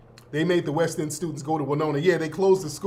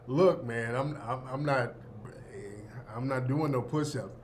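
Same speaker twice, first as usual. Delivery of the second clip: ordinary talk, nothing marked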